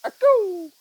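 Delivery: a sudden high, loud call into the room; calling out to test the echo. Real, not acted. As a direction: shout it out to hear it bounce back. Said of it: "Am I echoing in here?".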